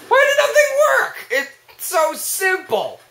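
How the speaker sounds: high screech easing into drawn-out complaint; exasperated yelling. Real, not acted. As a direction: shriek it, then drag out the words in frustration. Exasperated that inputs failed.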